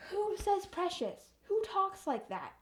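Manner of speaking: high-pitched disbelief; baffled, pitch jumping up on the question; incredulous. Real, not acted. Incredulous at a character's wording.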